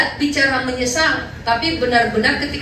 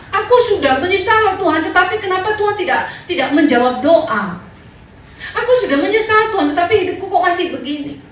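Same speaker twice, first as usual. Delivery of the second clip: higher and louder, whining to God; whining complaint; 7 semitones higher, 4 dB louder than usual. Acted. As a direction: plead like a sulking child. Whiny believer complaining to God. (merengek kepada Tuhan dengan nada tinggi)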